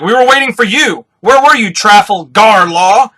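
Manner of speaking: high, loud hammed-up complaint; acted outraged yell; indignant scolding. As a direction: demand answers, drawing out the name. Cavendish scolds Law for being late.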